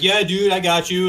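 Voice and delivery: a light mock of a relaxed musician saying yes; chill buddy reply. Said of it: "they'd go, like".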